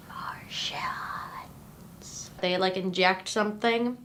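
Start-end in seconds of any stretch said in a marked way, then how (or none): 0.1-2.3 breathy whispered reveal; hushed, eerie whisper; spooky whisper. Acted. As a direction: whisper the word like a secret.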